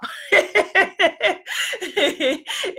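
high, drawn-out giggle; long, high-pitched real laugh; giggling laugh. Real, not acted. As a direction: laugh at your own remark in a high giggle. Laughs at calling it a job.

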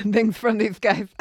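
slow words through real laughter; laughing. Real, not acted. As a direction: let the laugh spill over the words.

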